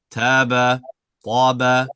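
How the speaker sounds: "Taba, taba" clear and firm, no added ha; clean taba. Shown as a demonstration. Teacher drilling the student, firm clean "Taba". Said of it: "So just try ba".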